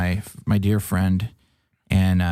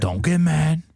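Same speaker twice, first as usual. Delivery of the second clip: higher-pitched imitated Michigan accent; 8 semitones higher, slower than usual. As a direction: warn him in a put-on Michigan accent. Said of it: "a very classic Michigan accent".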